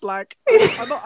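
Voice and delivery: normal talking voice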